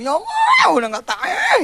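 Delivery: high, strained cry to God, half laughing; hammed-up despairing shout; mock desperate cry. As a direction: wail it up high like you can't take it anymore. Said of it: "Sampai teriak gitu".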